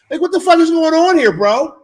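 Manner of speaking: loud, high, half-joking outburst; mock outraged yell. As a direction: yell it like you're fed up. Mock outrage at ringing phone.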